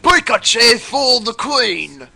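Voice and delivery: put-on British accent. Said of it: "Somebody wants a British Pikachu".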